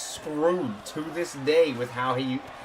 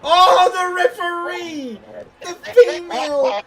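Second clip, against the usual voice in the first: much higher, much louder, slow yell; excited commentator shout; 10 semitones higher, 6 dB louder, slower than usual. Real, not acted. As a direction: erupt at the big moment. Referee knocked down, commentator erupts.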